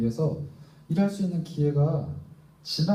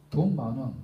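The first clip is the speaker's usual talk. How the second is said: ordinary delivery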